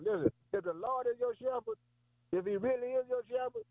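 fervent preaching. Real, not acted. Preacher urging his congregation, high and fervent. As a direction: rise up with fervour.

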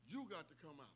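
hushed repeat of the call; quiet. Shown as a demonstration. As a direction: let it drop to a hush. Repeated line, then near silent.

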